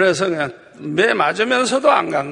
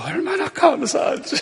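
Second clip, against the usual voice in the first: higher-pitched, real laughing speech; laughing gratitude; 7 semitones higher than usual. Real, not acted. Preacher jokes he beats the donkey, laughing. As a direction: talk through your chuckles. Laughs while joking about himself.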